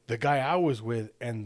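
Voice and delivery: ordinary talk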